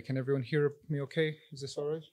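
ordinary talk

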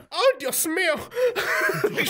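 high, drawn-out mock wail; imitated horrified mom. Mom seeing son hit the van, hammed-up horror. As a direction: stretch it out high like a shocked mother.

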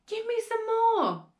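high, drawn-out demand; demanding cry. A narrator's reading. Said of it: "she cried".

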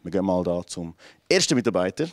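normal talking voice

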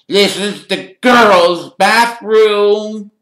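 loud, high, dragged-out whine; whiny ghost girl. Acted. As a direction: draw it out as a suspicious, whining ghost. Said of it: "eyeing Ron and Harry suspiciously".

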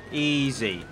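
ordinary delivery

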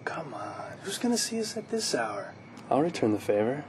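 coaxing whisper. Acted. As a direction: hush it, persuading. Teens sneaking, afraid of parents.

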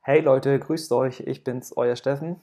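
usual speaking voice

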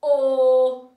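booming, very loud held vowel; powerful superhero call. Shown as a demonstration. Teacher showing the class a superhero voice, booming. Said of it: "say it in a superhero voice".

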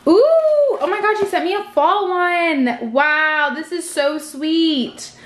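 high, delighted gushing; delighted surprise. Real, not acted. Vlogger opening a package she asked to be surprised by, delighted.

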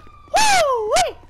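very high, loud whoop; whooping after the hit. Real, not acted. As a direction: let out a wild yelp of a rush. Reacting to the hit.

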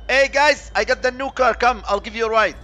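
loud, high hail to the guys; showing off loudly; cheery calling out. Pretend. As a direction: shout an invitation from the car. Calling to enemies from new car.

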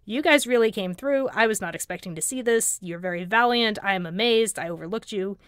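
ordinary talk, nothing marked

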